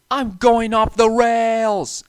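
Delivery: high, loud, drawn-out scream; terrified cry. A narrator's reading. James crashing off the track, crying out. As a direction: wail as you derail. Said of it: "exclaimed James".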